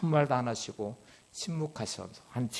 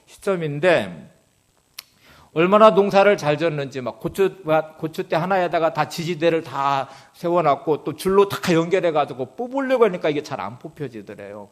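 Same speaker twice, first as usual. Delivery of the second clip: ordinary delivery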